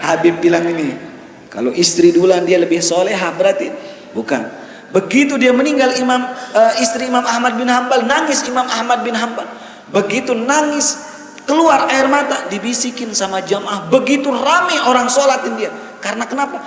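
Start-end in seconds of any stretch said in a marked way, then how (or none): 4.9-16.6 high-pitched, driving sermon delivery; impassioned preaching. Acted. Preacher telling the congregation of the Imam's grief, impassioned. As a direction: preach the story with rising fervour.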